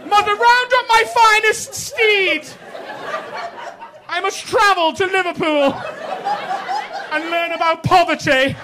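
overplayed plummy gentleman, slow and grand; hammed-up posh toff. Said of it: "do we have posh voices?".

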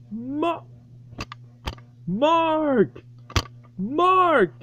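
yelling for Mark, getting no answer; calling out. Real, not acted. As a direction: call his name across the house.

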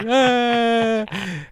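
imitating a little girl's high yelp; mock child shriek; startled shriek. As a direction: squeal like a kid grabbing poop. Mila finds goose turd.

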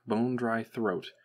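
ordinary delivery, nothing marked

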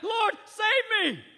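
slow, high, shouted plea; cried out. Acted. Peter sinking in the waves, shouted plea. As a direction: cry for rescue while sinking. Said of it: "cried out".

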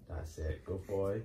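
soft coaxing. Real, not acted. Coaxing the dog quietly.

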